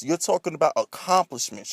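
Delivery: ordinary talk, nothing marked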